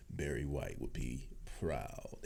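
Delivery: slightly hammed-up low, soft voice; deep-voice joke. Streamer joking to viewers about his bassy voice, playfully deep.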